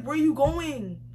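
usual speaking voice

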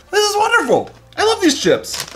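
high, delighted gushing; excited. Real, not acted. Gushing after reading label.

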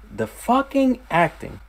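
"The fucking acting" thrown up high, with "fucking" hit hard; exclaimed in awe. Shown as a demonstration. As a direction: raise the line into amazed praise.

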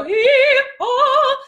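loud, high "hee, haw" exercise; stretched hee-haw vocal demo. Shown as a demonstration. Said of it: "Either if I go".

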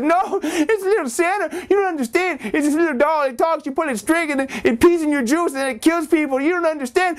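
put-on very high frantic child; very high frantic plea; desperate kid. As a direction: plead to be believed. Boy pleads in a straitjacket.

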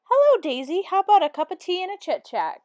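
high, loud hearty greeting; a drifter brother bursting in, hollering his hello; cheerful cry. A narrator's reading. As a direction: call out to your sister as you barge in. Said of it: "cried Danny".

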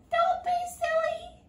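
very high, loud, slow scoff; dismissive princess. A narrator's reading. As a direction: brush the frog off haughtily. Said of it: "called back over her shoulder".

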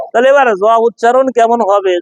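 ordinary delivery

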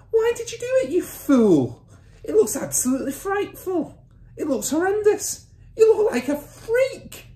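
very high, loud horrified scolding; mother's shriek. A narrator's reading. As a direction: shriek the insults at him. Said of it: "shrieked the mother".

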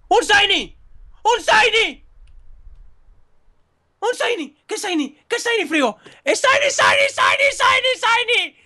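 high, incredulous yelps of joy; stunned excitement. Real, not acted. Player spotting a rare Shiny, ecstatic high cries. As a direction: repeat it in rising, disbelieving shrieks.

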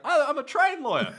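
high, loud broad Aussie voice; Australian accent, high and loud; Australian guy. Retold or imitated. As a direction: play the bloke calling from the back. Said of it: "Australian guy at the back's like".

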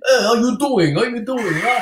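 put-on New York Italian accent. Singer trying the host's New York Italian accent. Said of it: "I'm gonna try to get your accent".

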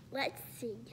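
whispered let's see to herself. Real, not acted. Kid whispering to herself while checking her toy list. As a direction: breathe it under her breath.

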